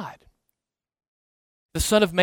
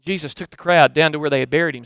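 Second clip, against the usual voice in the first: ordinary talk, nothing marked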